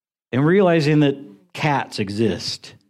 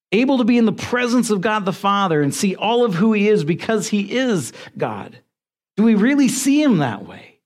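preaching; 7 semitones higher than usual. Acted. Preaching at full force.

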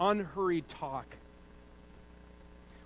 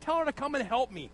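high-pitched demanding insistence; whiny order to Jesus; imitated demanding sister; 6 semitones higher than usual. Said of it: "it's a command".

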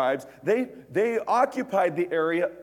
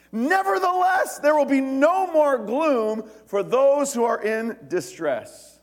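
high, loud, drawn-out Bible reading; scripture proclamation; 9 semitones higher, 6 dB louder, slower than usual. Acted. Preacher reading the prophecy to the congregation, proclaiming loudly. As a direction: proclaim it slowly, high and ringing out.

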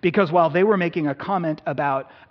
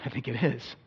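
softer, lower sheepish "oh yeah"; mild sheepish confession; sheepish admission; 5 semitones lower, 8 dB quieter, slower than usual. Retold or imitated. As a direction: concede quietly, caught out. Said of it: "often I'm going like".

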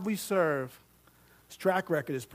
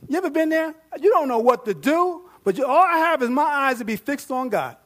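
raised, louder preaching build; fervent preaching; 9 semitones higher, 9 dB louder than usual. Real, not acted. Preacher urging the congregation, fervent and loud. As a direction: urge them to fix their eyes on God.

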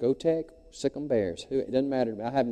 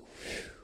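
breathy whoosh; 19 dB quieter than usual. Retold or imitated. Wind of the Spirit sound.

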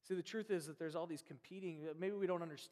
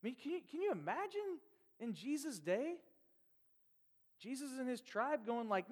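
usual speaking voice

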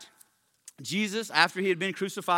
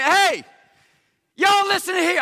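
high, loud holler to a crowd; bold call-out; 10 semitones higher, 7 dB louder than usual. Retold or imitated. Peter stepping up to address the crowd, loud and bold. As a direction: step up and demand their attention. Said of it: "steps up and he's like".

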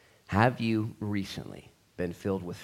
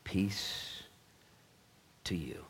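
soft peace; 3 semitones lower, 10 dB quieter, much slower than usual. Acted. Softly repeats Jesus's words.

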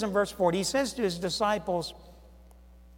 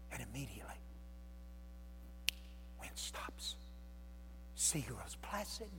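hushed; 7 semitones lower, 18 dB quieter than usual. A narrator's reading. Preacher describing the calm sea, whispered. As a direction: let it fall to a hush. Storm falls silent.

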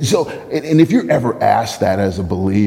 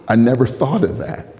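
ordinary delivery

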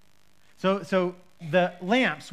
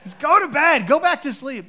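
louder, higher weary order; exasperated parent; 7 semitones higher, 8 dB louder than usual. Retold or imitated. The parents repeat to the toddler to go back to sleep. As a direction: tell the kid off like a tired parent. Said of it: "We're so compassionate".

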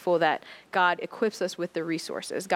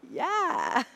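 stunned yeah; 10 semitones higher than usual. Retold or imitated. Her stunned reaction.

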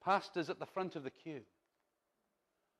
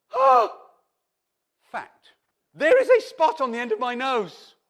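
much higher and louder startled oh, then a loud complaint; mock dismay; 8 semitones higher, 14 dB louder than usual. Woman noticing a pimple on her nose, in mock dismay. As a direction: gasp at the spot, half-joking. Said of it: "And you say".

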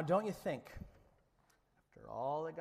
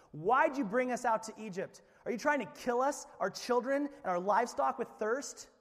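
raised, higher-pitched accusing questions; arguing; 7 semitones higher, 5 dB louder than usual. A narrator's reading. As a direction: argue back loudly, hurling complaints. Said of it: "they continued to argue with Moses".

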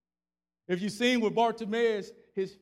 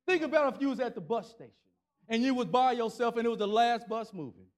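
ordinary talk

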